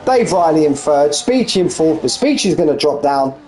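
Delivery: live race commentary. Acted. Commentator calling the sim race finish to viewers. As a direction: call the final laps as they unfold.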